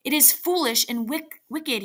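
plain reading voice

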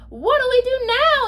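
high, loud pirate question; panicky yell. A narrator's reading. Pirate yells after the mast splits, high loud yell. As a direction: yell it out for help. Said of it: "yelled one of the pirates".

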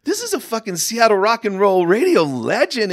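high, loud starstruck gushing; giddy. Retold or imitated. Fan meeting a radio legend, giddy, high and loud. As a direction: gush like a starstruck kid. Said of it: "I'm giddy".

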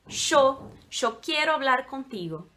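"yo" pronunciado "cho"; chiado argentino. Shown as a demonstration. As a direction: espanhol argentino com "yo" chiado. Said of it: "eles falam muito chiado". ("yo" com som de "ch", à argentina)

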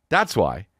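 ordinary delivery

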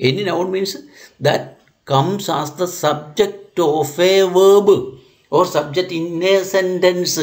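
slow, word-by-word dictation. Acted. Teacher dictating the definition to students, slow and measured. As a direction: lay each word down for students to copy.

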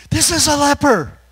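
very high, loud scandalised squeal; over-the-top mock alarm; mocking disciple. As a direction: ham up the shocked followers. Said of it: "like she wouldn't know".

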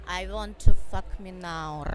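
put-on slow, sultry purr with a Russian accent; seductive Russian accent. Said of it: "Tell me something sexy".

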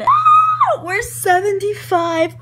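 shriek, then loud high slow announcement; excited scream. Real, not acted. Vlogger tells viewers they're seventy-five away, screaming with excitement. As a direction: squeal it out, voice way up. Celebrating nearing a subscriber milestone.

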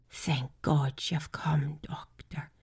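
slow whisper. Acted. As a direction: whisper urgently to the doctor. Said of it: "she whispered".

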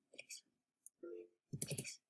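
breathless whispered begging; whispered plea. Real, not acted. Player begging others to team, whispering please. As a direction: plead under your breath.

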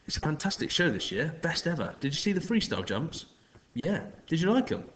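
a strange man feigning polite concern; smarmy stranger. A narrator's reading. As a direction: charm the kids with a fake smile. The man chats to kids.

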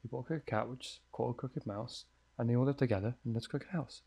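hushed spooky rhyme recital; reading a rhyme. Acted. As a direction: read the rhyme like a creepy note. Reads nursery rhyme from note.

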